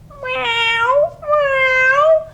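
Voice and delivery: high mock cat meow. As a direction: meow like a playful kitten. Cat's call in story.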